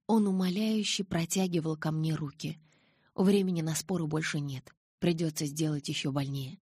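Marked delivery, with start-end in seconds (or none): none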